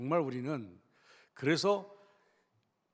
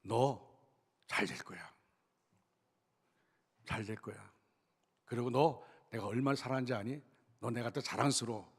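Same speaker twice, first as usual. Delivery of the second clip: low, soft fatherly encouragement; reassuring father; 5 semitones lower, 5 dB quieter than usual. Retold or imitated. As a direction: gently voice a parent holding back hurt to reassure a child. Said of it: "좀 속이 상해도 이랬답니다".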